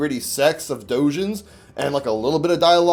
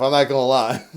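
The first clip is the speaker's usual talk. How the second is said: ordinary talk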